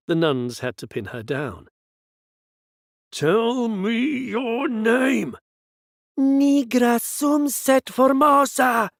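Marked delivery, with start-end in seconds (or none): 3.1-5.4 very slow, high, loud command; exorcist's shout. Acted. Priest shouting at the possessed woman, slow roar. 6.2-8.9 high, loud, slow possessed yell; yelled Latin. Acted. Possessed woman yelling Latin at exorcist, high yell. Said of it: "Rosaria yelled in Latin".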